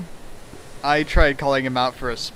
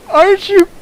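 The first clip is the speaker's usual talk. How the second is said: very high, loud squeaky servant; a startled shikigami squeak; fox servant voice; 8 dB louder than usual. Acted. Voicing Ran in fanfic reading.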